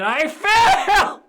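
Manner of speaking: loud, high, drawn-out laughing wail; comic outburst, breaking into laughter; laughing exclamation. Real, not acted. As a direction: announce failure in a rising, laughing cry. Laughing at own failed roll.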